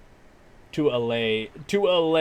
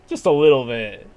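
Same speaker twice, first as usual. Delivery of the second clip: teasing emphasis; 2 semitones higher, 9 dB louder than usual. Real, not acted. Teasing Paul's little help.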